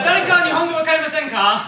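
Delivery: fast, high, loud quoted question; staff asking in Japanese. Retold or imitated. Staff asking if anyone doesn't know Japanese, fast and high. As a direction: rattle it off like the orientation staff. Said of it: "So they're like".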